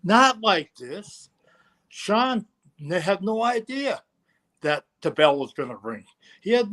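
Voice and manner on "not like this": high, slow, tearful wail; crying plea. Retold or imitated. Wrestler on the mat crying to the referee, slow tearful plea. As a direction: plead through tears. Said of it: "And he's crying".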